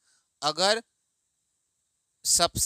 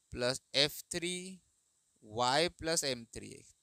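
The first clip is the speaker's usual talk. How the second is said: slow and softer, term by term; slow formula dictation; 2 semitones lower, 7 dB quieter, slower than usual. Shown as a demonstration. Teacher writing out the three-root formula, slowly. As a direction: dictate each term with pauses. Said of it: "अगर तीन roots है तो".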